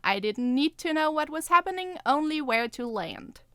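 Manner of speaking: ordinary delivery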